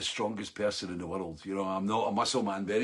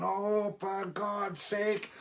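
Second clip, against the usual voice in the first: strained groan; 8 semitones higher, 4 dB quieter, much slower than usual. Retold or imitated. Arm giving out mid-air.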